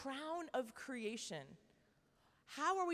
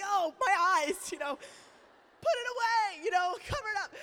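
mock horror; 8 semitones higher, 12 dB louder than usual. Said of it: "you're like".

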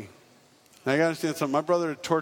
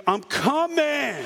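high, long-drawn call; mock watchman calling across a graveyard; 7 semitones higher, much slower than usual. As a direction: stretch it out as a hammed-up holler. Watchman calling to the buried man.

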